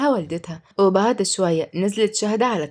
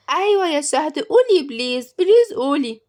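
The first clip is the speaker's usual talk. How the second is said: put-on child begging; 7 semitones higher, 4 dB louder than usual. A narrator's reading.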